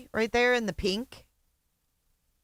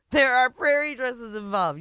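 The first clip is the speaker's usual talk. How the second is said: high mock echo; 6 semitones higher, 3 dB louder than usual. Mocking echo again, higher.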